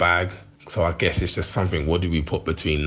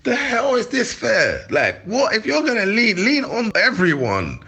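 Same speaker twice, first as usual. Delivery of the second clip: high, exasperated complaint; indignant rant. Real, not acted. Ranting to the interviewer about unfair treatment, indignant and high. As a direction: vent your outrage at the unfairness.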